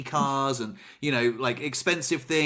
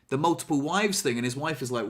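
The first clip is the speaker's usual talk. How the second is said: usual speaking voice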